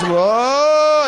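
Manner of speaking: a high, loud, laughing whoa; lightly hammed ghostly whoa; mock ghost whoa. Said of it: "Marley's still there and goes".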